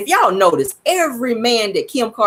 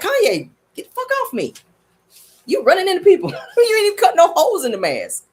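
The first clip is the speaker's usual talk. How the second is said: annoyed bystanders; 9 semitones higher than usual. Retold or imitated. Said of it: "They like".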